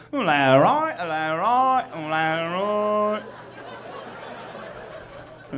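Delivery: repetitive mock British babble; British gibberish. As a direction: loop slurred British vowels. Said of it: "but all I hear is".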